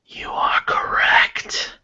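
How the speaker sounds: slow, hushed whisper; eerie drawn-out whisper; whispered reveal. Acted. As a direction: breathe it out slowly like a secret. Game master reveals the riddle's answer.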